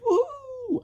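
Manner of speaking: high loud whoop; cheer. Acted. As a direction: whoop like a delighted child. Kids cheering.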